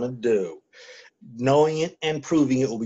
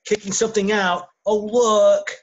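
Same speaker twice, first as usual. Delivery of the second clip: sudden excitement; 8 semitones higher than usual. Real, not acted. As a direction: stop everyone, something just popped up. Phone suddenly alerts mid-conversation.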